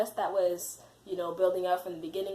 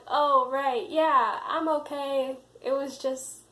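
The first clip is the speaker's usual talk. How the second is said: high, louder start, easing in volume; retold brushing off; 6 semitones higher, 5 dB louder, slower than usual. Embarrassed student to head RA checking in, high brush-off. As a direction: act out insisting all is fine, pitched up.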